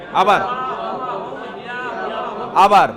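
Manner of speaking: calls out loudly for the class to repeat. Shown as a demonstration. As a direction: call it out, urging a chorus. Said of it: "সবাই একসাথে বলেন।". (জোরে ডাক দিয়ে আবার বলা)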